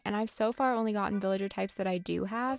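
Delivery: ordinary delivery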